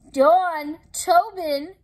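loud call of the names; hollered. A narrator's reading. Bismarck hollering to alert his friends. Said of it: "Bismarck hollered".

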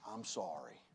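soft, low I'm sorry; gentle apology; humble apology. Shown as a demonstration. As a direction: say it humbly and quietly.

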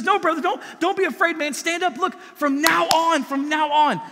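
high, loud, urgent encouragement; Jesus, fervent. Retold or imitated. Preacher voicing Jesus urging Peter to stand up, loud and fervent. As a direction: lift him up like a big brother. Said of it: "and Jesus says".

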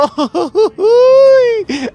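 high, loud shrieking laugh; wild alien cackle as he flies off; maniacal cackle. Real, not acted. Laughs while abducting.

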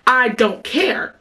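slow, drawn-out I don't care; dismissive drawl. Retold or imitated. Dismissing a man's angry messages.

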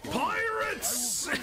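very high cartoon cry; alarmed cry. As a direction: yelp it like a panicked sailor.

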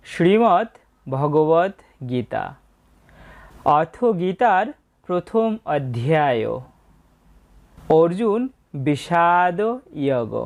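very slow, drawn-out invocation; solemn recitation. Acted. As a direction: intone each word slowly with long vowels. Opens by reciting invocation and title.

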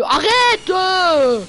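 high, loud whining protest; whiny yell. Real, not acted. Yelling at a teammate who keeps hitting him, whiny and loud. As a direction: yell at him to stop, dragging it out.

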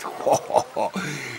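a soft, breathy chuckle of delight; amused laugh. Acted. Roman master laughing at his slave's wine idea, amused chuckle. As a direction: chuckle softly, pleased with the news.

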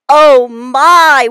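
mild shocked gasp of "oh my"; a class of kids crying out in dismay; cried out. A narrator's reading. Children gasping at the zoo, mild alarm. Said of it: "we all cried".

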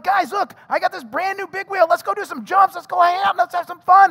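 high, loud, excited invitation; eager kid. Retold or imitated. Boy begs his friends to come ride, high and excited. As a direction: show off the new toy, bursting with excitement. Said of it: "And I'm like".

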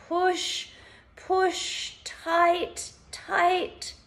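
high, effortful one-word commands; urging to tense. Shown as a demonstration. Instructor urging listeners to push fists down, urging to tense.

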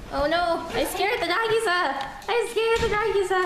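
high hammed-up alarm; mock panic. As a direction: exaggerate the panic.

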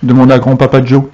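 ordinary delivery

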